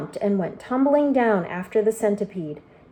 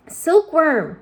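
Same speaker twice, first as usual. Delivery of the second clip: a high, loud call across the deck; urgent yell; 8 semitones higher, 8 dB louder than usual. Acted. James ordering the silkworm to spin, yelling. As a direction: shout her name to get her moving. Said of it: "yelled James".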